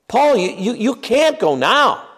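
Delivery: pleading friends. Retold or imitated. Friends begging Paul not to go.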